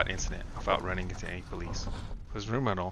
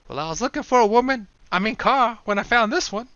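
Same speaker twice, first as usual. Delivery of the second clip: much higher and louder wisecrack; a cocky, delighted joke; Will's joking voice; 13 semitones higher, 9 dB louder than usual. Acted. Will gloating over his winnings, loud high wisecrack.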